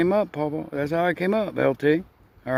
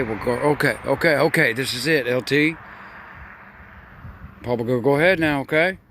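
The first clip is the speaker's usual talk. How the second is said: ordinary talk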